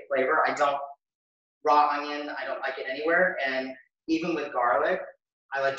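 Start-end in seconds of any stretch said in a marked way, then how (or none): none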